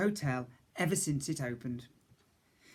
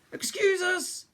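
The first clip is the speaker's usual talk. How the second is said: high, louder passing call; cook calling out; 4 dB louder, slower than usual. A narrator's reading. Cook calls to the banshee, high and loud. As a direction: call it out as you dash by. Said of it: "Nancy shouted".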